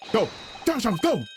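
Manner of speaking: urgent cheer. Acted. Pushing Sholmes through the door, loud and high. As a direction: hurry him on with a cry. Urging partner to hurry.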